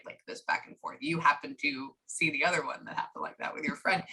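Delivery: unvoiced confiding whisper; whispered aside. Real, not acted. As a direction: lean in and whisper it. Aside to client.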